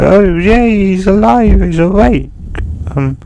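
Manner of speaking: put-on high, loud, delighted kid voice; excited greeting. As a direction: burst out with joy at a new brother. Boy greets newfound brother happily.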